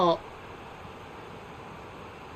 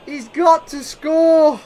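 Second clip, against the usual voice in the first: loud, slow, higher plea; urgent call; 6 semitones higher, 9 dB louder, much slower than usual. Real, not acted. Gamer urging his striker on, loud slow plea. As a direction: stretch it out, willing him to score. Repeat after the chance.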